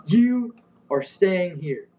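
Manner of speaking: very slow, high-pitched mock order; imitated yelling. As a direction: draw out a retold angry command. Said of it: "he starts yelling at me".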